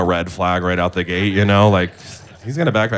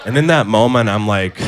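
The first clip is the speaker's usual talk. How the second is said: ordinary talk, nothing marked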